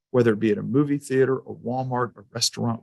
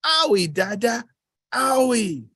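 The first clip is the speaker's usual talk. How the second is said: put-on high, whiny toddler voice, much higher; 11 semitones higher, slower than usual. Dad imitating his toddler son's complaint, high toddler whine. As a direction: whimper it like a crying two-year-old. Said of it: "in true, like, two-year-old form".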